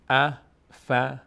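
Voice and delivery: slow, drawn-out chanted Arabic recitation; slow Quran recitation. Shown as a demonstration. Teacher slowly reciting the verse for the student, chanted. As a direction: stretch every syllable as in tajweed. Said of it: "teacher recites".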